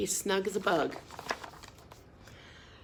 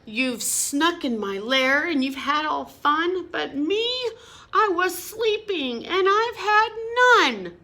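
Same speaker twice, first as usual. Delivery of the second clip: higher, louder sulky bear complaint; whiny bear; 7 semitones higher, 5 dB louder than usual. Acted. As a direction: whine like a grumpy woken bear.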